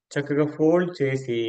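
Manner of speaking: normal talking voice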